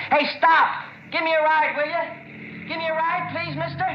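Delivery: high-pitched pleading shout; urgent, begging roadside yell; calling out desperately. Acted. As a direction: holler after a passing car. Flagging down cars for a ride.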